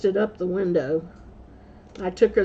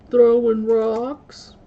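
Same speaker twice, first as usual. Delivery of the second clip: put-on sheepish kids' answer; 6 dB louder, much slower than usual. Kids answer the caught deed.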